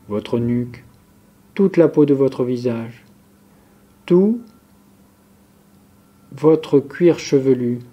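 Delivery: ordinary delivery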